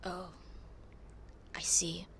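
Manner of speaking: a dry whispered I see. Acted. Muttering back at the accusing classmates, whispered. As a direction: breathe it under your breath.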